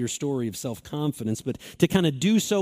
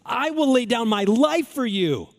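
Peter's proud boast; 7 semitones higher, 3 dB louder than usual. Retold or imitated. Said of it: "Listen to the words".

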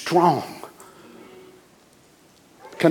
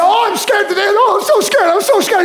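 high, loud mock panic; mock fear; 3 dB louder than usual. The preacher mocks what Paul never said, in high hammed-up fear. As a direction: wail it out like a hammed-up coward. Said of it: "Paul didn't say".

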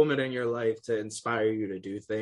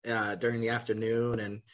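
ordinary delivery

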